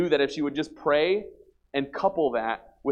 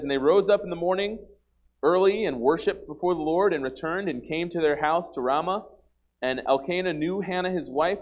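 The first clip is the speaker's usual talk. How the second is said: raised, higher reading voice for the verses; scripture reading; 6 semitones higher, 4 dB louder than usual. Acted. Preacher reading the Bible passage aloud, raised and proclaiming.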